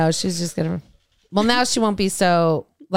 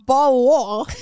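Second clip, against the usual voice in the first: higher-pitched British echo; mock British accent; 4 semitones higher than usual. Echoing the British people video, mock British accent. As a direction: copy the British swallowed consonants.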